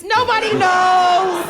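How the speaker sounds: loud, slow, drawn-out holler; mock-exasperated cry to the crowd; exasperated shout. Wife fed up explaining where Dutch is from, shouting.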